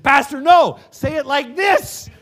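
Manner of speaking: high, loud laughing correction; high laughing shout, half amused; correcting shout. Retold or imitated. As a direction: call out the fix with a laugh. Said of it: "someone said to him".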